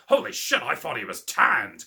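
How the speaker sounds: mock shocked outburst, loud; hammed-up astonished exclamation; Vince McMahon. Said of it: "you could just see Vince McMahon's like".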